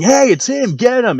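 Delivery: high excited group yell; mock yell. Campers chasing him, mock yelling. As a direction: shout it like a pack of kids chasing. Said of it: "come back yelling".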